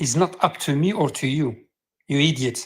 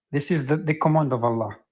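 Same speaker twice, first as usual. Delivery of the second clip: usual speaking voice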